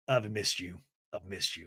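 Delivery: very low, soft lover's voice; tender confession. Retold or imitated. Jared tells his ex he missed her, low and tender. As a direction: drop low and quiet, mock-romantic.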